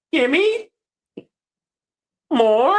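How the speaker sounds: very high drawn-out squeaky tones; strange noises. Retold or imitated. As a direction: imitate odd high noises, stretched out. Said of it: "making strange noises".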